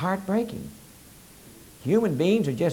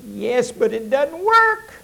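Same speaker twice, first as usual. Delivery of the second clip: trapped female coon; 9 semitones higher, slower than usual. Acted. Trapped coon answers him.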